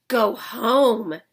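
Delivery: loud, fed-up dismissal; exasperated doctor snapping at Amelia; angry order. Acted. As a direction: send her away in fury. Doctor's face turned red.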